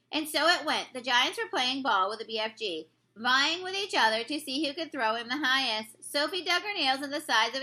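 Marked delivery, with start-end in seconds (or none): none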